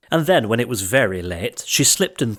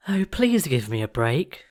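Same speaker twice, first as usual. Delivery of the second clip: pleading cry; 3 semitones higher than usual. A narrator's reading. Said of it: "She cried".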